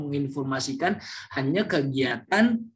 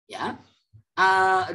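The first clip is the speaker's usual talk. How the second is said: ordinary delivery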